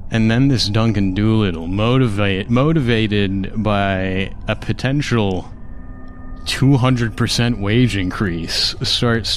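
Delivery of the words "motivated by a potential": ordinary delivery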